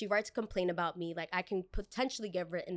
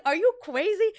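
incredulous outburst; 11 semitones higher, 8 dB louder than usual. Retold or imitated. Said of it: "I was like".

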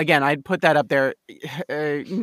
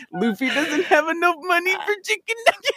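mock Vine voice; 11 semitones higher than usual. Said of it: "I'm just like".

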